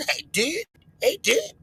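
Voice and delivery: "did" said with a short i, like dude squeezed flat. Retold or imitated.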